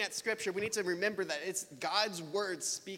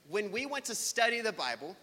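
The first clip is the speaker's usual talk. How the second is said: usual speaking voice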